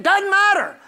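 high, forceful pulpit rebuttal; emphatic dismissal. Real, not acted. Preacher answering "I can't feel it", emphatic high dismissal. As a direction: cut the objection down hard.

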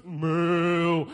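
sung, drawn-out cow moo; mock moo. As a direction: moo like a silly kid. Kid mooing at dad.

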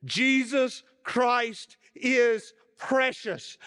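high, loud, slow proclamation; old preacher proclaiming to the whole church; loud exclamation. A narrator's reading. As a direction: ring it out to shake the walls. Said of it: "in the loudest voice possible".

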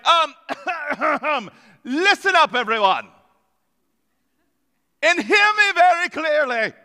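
a stuffy British official clearing his throat; mock British accent. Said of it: "I'm sure he spoke in a British accent".

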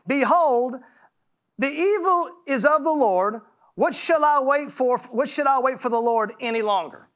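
solemn pulpit reading; scripture reading. Acted. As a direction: proclaim the verses from the pulpit. Reads scripture aloud.

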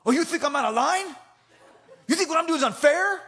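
loud, high indignant bellow; yelling coach. Retold or imitated. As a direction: challenge him at a yell, as the angry coach. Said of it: "started to yell at me".

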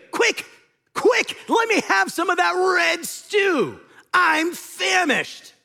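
high, loud, slow starving cry; famished hunter begging at full volume; desperate shout. Acted. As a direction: yell it like a man dying of hunger. Esau voiced demanding stew.

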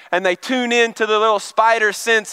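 ordinary talk

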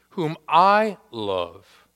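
very slow, "I" leaned on hard; stress on I. Shown as a demonstration. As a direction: press the word "I" as the emphatic point. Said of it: "I is in the emphatic position".

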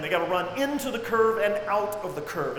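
usual speaking voice

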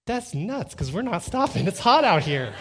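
high, loud, swooping protest; hammed-up dad putting his foot down; exasperated refusal. Father refusing his son's sketching stops, exasperated.

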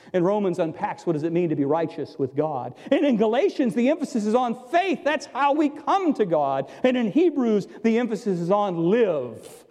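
usual speaking voice

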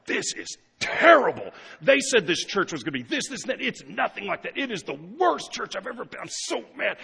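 high, mock-indignant complaint; disgruntled member. Pastor voices a disappointed churchgoer, mock indignant. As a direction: whine like a let-down member. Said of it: "they're like".

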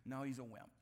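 ordinary talk

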